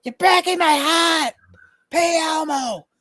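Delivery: high, slow squeaky Elmo. Retold or imitated. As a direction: plead in a squeaky puppet voice. Pushy Elmo demanding payment.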